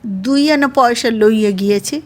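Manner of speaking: usual speaking voice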